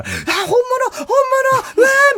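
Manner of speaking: high-pitched, star-struck squeals; excited fan reaction. Retold or imitated. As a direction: gush like a fan spotting celebrities. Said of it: "テンション高めの人のリアクションと一緒". (興奮したファンの甲高い声)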